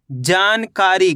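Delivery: जानकारी slow, with a long जा and कारी; drawn-out sounding-out. Shown as a demonstration. Teacher showing the long vowel for spelling, slow and drawn out. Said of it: "जा का उच्चारण खींच को रहा है".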